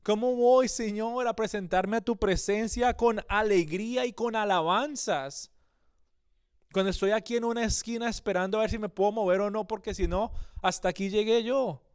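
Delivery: anguished plea. Retold or imitated. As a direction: cry out to God as a hunted man at the end of his rope. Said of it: "llorando, incluso angustiado". (súplica angustiada, más aguda y fuerte, casi llorando)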